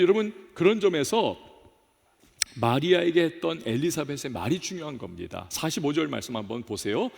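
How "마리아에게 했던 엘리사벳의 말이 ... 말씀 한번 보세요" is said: ordinary talk, nothing marked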